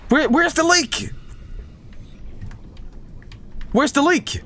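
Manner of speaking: high, urgent panicked cries. Real, not acted. Gamer hunting for the leak after security breach, panicked. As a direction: pitch it up in mounting alarm.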